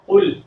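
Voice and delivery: قل with a deep, heavy qaaf from the throat; قل pronounced with a deep qaaf. Shown as a demonstration. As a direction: say قل with a thick back qaaf, loud. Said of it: "موٹے قاف کے ساتھ پڑھنا ہے".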